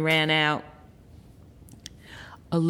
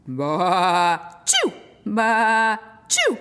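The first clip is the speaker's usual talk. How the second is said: sneezing sheep; 3 dB louder, much slower than usual. A narrator's reading. Narrator as the sheep, bleating sneeze. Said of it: "Sheep jumped up".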